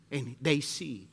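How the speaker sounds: usual speaking voice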